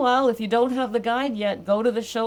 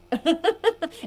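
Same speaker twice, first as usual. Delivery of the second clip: laughing; 10 semitones higher, 4 dB louder than usual. Real, not acted. Delighted by client story.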